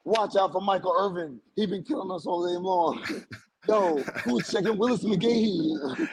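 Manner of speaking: mock yell, amused and stretched at the end; retold yelling out. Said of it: "I would just yell out".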